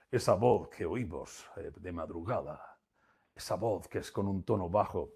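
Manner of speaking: exaggerated late-night radio DJ voice; late-night radio host voice. Shown as a demonstration. Trainer demos to the audience the late-night radio host tone. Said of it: "esto es un poco exagerado".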